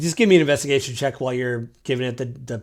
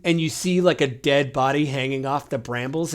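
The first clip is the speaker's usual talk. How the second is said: ordinary talk, nothing marked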